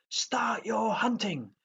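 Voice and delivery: slow, high, breathy yell; read-aloud yell. A narrator's reading. Gobber starts the hunt, a slow high breathy yell. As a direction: call the hunt out with a hushed high shout. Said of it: "yelled Gobber the Belch".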